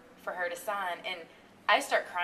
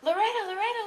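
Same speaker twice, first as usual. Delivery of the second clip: high, louder calls rising at the end; calling out; 14 semitones higher, 6 dB louder than usual. Retold or imitated. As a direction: call for her across a crowd. People around going.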